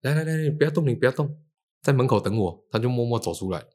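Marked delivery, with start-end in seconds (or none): 0.0-2.7 higher, urgent call to stop; urgent alarm. Retold or imitated. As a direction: freeze someone in place with alarm. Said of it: "那个朋友走出来就看到我说，".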